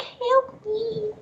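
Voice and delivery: very high, soft, helpless kitten plea; tiny kitten voice. Pretend. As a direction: squeak it like a lost little kitten. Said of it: "waiting for a little kitten to toddle into my driveway and be like".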